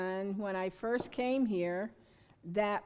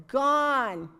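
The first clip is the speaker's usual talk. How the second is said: normal talking voice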